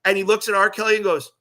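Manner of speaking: ordinary talk, nothing marked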